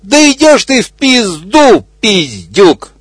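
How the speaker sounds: high yell under attack. Acted. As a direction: howl it out at the crocodile. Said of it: "а тот орёт". (высокий крик, бегемот орёт на крокодила)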